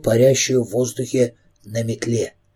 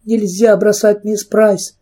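высоко и медленно, в панике; умоляй, почти крича; отчаянный выкрик; 6 semitones higher, slower than usual. A narrator's reading. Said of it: "выкрикнула Кэрри не своим голосом". (отчаянный высокий выкрик)

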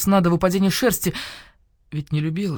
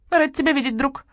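parroted greeting; 10 semitones higher than usual. Acted. Starling parrots greeting back.

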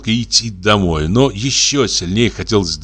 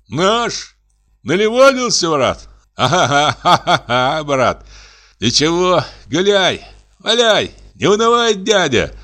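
put-on drunken voice; 8 semitones higher than usual. Drunk student greeting Vasilyev, high and tipsy cheer. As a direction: play a merry drunk student hailing a stranger. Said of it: "сказал пьяным голосом".

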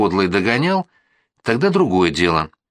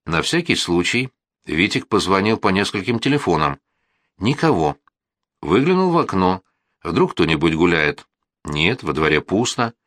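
usual speaking voice

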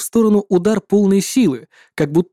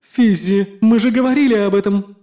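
scolding herself; reproachful; 2 semitones higher than usual. Acted. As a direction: chide yourself firmly. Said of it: "упрекнула она себя".

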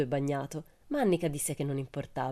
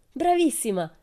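high, delighted cheer; excited exclamation; 9 semitones higher, 4 dB louder than usual. A narrator's reading. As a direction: exclaim it brightly, pitched well up. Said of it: "esclamarono Tommy e Annika".

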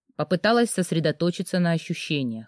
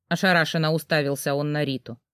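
ordinary delivery, nothing marked

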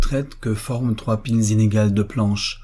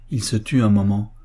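ordinary delivery

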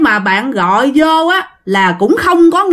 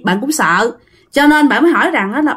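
usual speaking voice

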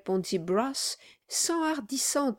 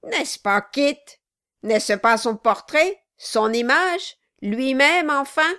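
shouted, raised pitch, furious; dwarf's cry; 5 semitones higher, 7 dB louder than usual. Acted. The dwarf yells at the wooden figurehead, furious. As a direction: yell it while raining blows. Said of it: "cria le nain".

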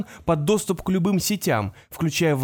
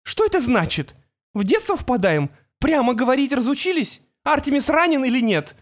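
high, sharp, demanding; angry mother; 9 semitones higher than usual. A narrator's reading. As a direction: snap the questions in anger. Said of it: "закипает от злости".

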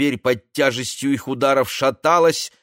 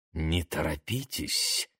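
low slow intimate hush; seductive whisper; 6 semitones lower, 10 dB quieter, slower than usual. Acted. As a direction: breathe it softly into her ear. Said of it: "прошептал Кровопусков".

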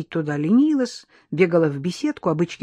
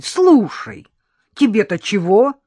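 higher and louder, irritated snap; getting angry; 4 semitones higher, 9 dB louder, slower than usual. A narrator's reading. Maid snaps at the nosy nanny, annoyed. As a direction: bristle at her prying. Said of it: "начала злиться Кася".

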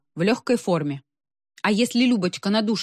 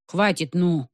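plain reading voice

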